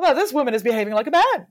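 high, loud, fast mock outrage; scandalized. Europeans disapproving of a woman ruler, high mock outrage. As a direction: huff it out like a scandalized courtier. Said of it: "pearl clutching".

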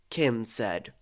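plain reading voice

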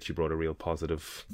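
normal talking voice

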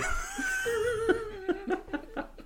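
long high-pitched laughing fit; real laughter over the joke. Real, not acted.

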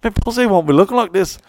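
high, loud mock Irish girl; girlfriend voice. [name] imitating his Irish girlfriend, high and loud. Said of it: "she goes".

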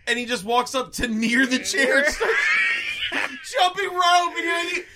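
laughing rant. Real, not acted. Friend recounting the jump rope shot, laughing shout. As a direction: howl through it in delight.

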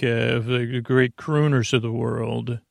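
ordinary talk, nothing marked

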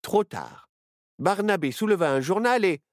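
plain reading voice

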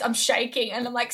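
ordinary delivery

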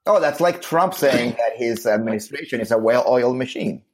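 normal talking voice

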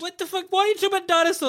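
high incredulous voice. Pretend. Mock arguing with own body.

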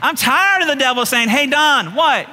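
impassioned pulpit delivery; fired-up preaching. Real, not acted. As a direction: preach it with rising exasperation. Preaches defiance of devil.

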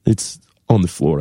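a moved fan pouring out feelings; lightly voiced gushing fan; emotional German fan. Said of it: "Then suddenly they're like".

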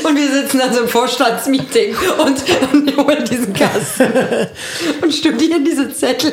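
high, laughing through the words. Real, not acted. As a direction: giggle all the way through. Pictures board meeting, laughing.

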